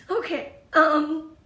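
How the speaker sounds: slow, still a little choked; collecting herself to carry on; pulling herself together. Real, not acted. Steadying her voice to go on with the tips. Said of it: "I get emotional".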